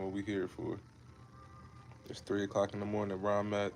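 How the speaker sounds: ordinary talk, nothing marked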